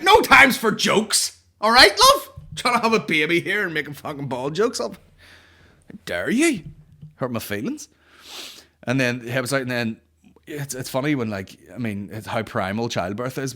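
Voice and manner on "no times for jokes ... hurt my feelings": high, loud indignant yell; comically furious scolding; mock outrage. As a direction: shout it in hammed-up offence, easing off. Mock outrage at midwife's joke.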